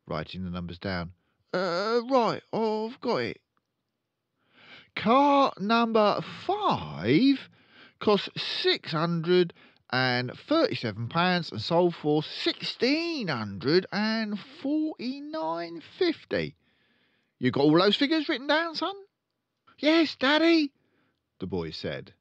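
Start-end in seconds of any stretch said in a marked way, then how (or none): 1.5-18.9 blustering, slow, high car-dealer dad; pompous father voice; loud pompous father. Acted. 19.8-20.7 put-on high, loud little boy; young son voice.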